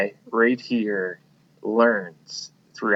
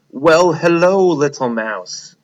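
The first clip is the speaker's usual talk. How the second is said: a hint of a sly lion, bright greeting; lightly voiced lion eyeing a snack; 6 semitones higher, 7 dB louder, slower than usual. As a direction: voice the hungry lion sweetly. Lion greets mouse in story.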